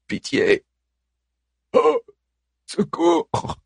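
high, strained plea of a dying man; dying murmur. Acted. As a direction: beg for help while dying. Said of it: "murmure le mourant".

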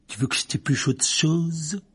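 mock him across the table; sneering Malfoy. Malfoy taunts Harry. (ton narquois)

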